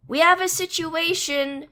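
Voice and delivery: loud, high call down the hall; yelling. A narrator's reading. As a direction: yell it so they hear from far off. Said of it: "Disciple yells from down the hall".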